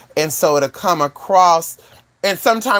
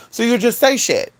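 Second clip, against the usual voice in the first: ordinary delivery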